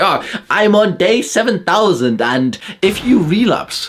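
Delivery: high, slow, smug parody voice; mocking smug YouTuber. Parodying nofap YouTubers for viewers, slow high smug voice. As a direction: drag it out like a preachy guru. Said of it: "It's like".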